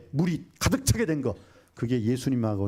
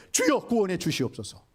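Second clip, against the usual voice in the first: crying out; 6 semitones higher than usual. Acted. Acted prayer from the deep sea.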